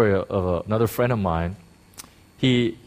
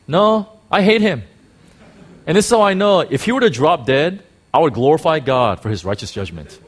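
higher and louder, flat-out defiant; blunt loud retort; 4 semitones higher, 5 dB louder than usual. Retold or imitated. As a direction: throw it back at the elder, no apologies. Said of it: "friend turned and says".

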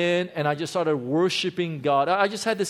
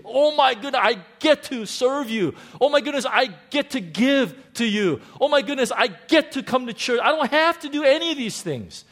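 high, loud, bursting with joy; overjoyed; 8 semitones higher, 5 dB louder than usual. Retold or imitated. Pastor reliving his joy in the car, overjoyed and loud. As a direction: cry out in amazed delight. Ecstatic retold realization, shouting.

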